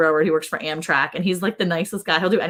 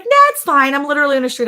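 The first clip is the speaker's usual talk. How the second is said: teasing reveal; 7 semitones higher, 5 dB louder than usual. Retold or imitated. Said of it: "I was like".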